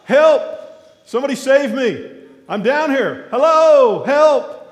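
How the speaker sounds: high, loud panicked yelling for rescue; shouted cries from the water, well above the storytelling voice; desperate cry for help. Retold or imitated. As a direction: call out for help as if drowning. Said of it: "he begins to cry out".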